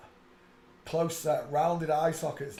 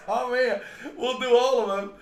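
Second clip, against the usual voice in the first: higher, louder words through laughter; laughing; 11 semitones higher, 9 dB louder than usual. Real, not acted. Amused planning the next pick, laughing higher. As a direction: chuckle it out.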